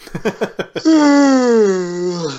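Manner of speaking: long noisy yawn, rising then falling; loud yawn. Real, not acted. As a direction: yawn out loud mid-chat. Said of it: "Sorry, I'm yawning".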